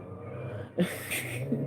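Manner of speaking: soft unvoiced laugh close to crying; breathy half-cry laugh; teary laugh. Real, not acted. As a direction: laugh it off through tears. Laugh after admitting sibling fights.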